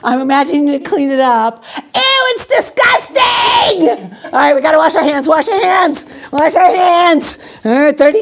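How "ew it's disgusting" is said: high, slow, loud hammed-up disgust; mock disgust. Performer squealing over the imaginary egg mess.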